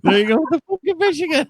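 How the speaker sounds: high, fast teasing laugh-talk. Pretend. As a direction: tease through laughter, high and quick. Mock complaint while laughing.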